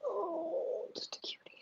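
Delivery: soft whispered coo. Real, not acted. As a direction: whisper it sweetly to a pet. Cooing at a pet.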